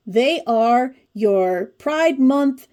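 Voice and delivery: usual speaking voice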